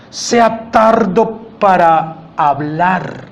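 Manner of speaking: drawn out, very slow; slow motion. Shown as a demonstration. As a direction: stretch every word slowly. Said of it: "en cámara lenta".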